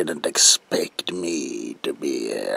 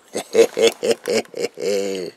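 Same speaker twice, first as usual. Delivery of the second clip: loud manic cackle; high, loud villain cackle; evil cackle; 6 semitones higher, 6 dB louder than usual. Acted. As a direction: cackle with glee at the patient. Villain laugh.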